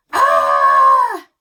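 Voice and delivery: loud, high startled shriek. A narrator's reading. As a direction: shriek in sudden fright at a snake. Dad shrieks at snake.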